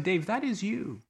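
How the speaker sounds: convicting inner voice. Retold or imitated. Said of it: "I was convicted of the moment of saying".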